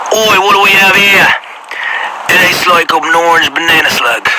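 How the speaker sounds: hammed-up British voice, poking fun at his own accent; put-on British accent. Finding a slug outside at night, in a bad British accent. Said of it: "I'm horrible with accents".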